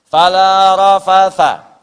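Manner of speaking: slow, loud chanted Arabic words; Quran recitation. Acted. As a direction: draw out the verse loud and slow.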